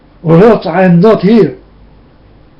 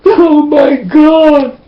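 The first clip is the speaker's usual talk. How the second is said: slow, crying cry to God; tearful, drawn-out exclamation; sobbing; 6 semitones higher, much slower than usual. Real, not acted. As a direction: let it fall apart in tears. Overwhelmed outburst while crying.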